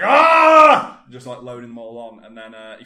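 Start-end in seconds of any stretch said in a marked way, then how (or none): 0.0-1.0 huge mock roar, much higher and louder; strongman roar. Strongman hurling bowls, a huge mock roar. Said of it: "He's just like".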